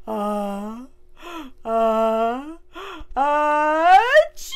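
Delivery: gasp, then a rising sneeze build-up; about to sneeze. Acted. Ant sneezes.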